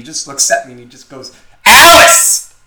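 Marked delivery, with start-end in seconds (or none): 1.7-2.5 sudden high loud yell of the name; yelled fake name. Retelling the stoned kid shouting his name at the driver, high loud yell.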